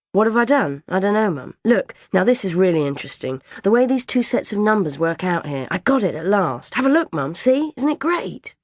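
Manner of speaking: high, loud enthusiasm; eager child showing mum; excited boy. A narrator's reading. Boy excitedly shows his numbers.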